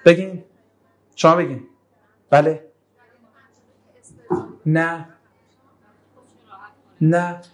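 ordinary delivery

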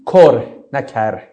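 first کر with u, then کر with a, slow; kurh versus karh. Shown as a demonstration. Teacher separating two Arabic words, slow pronunciation. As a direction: contrast the vowels of کر clearly. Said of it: "کر سختی با رغبته".

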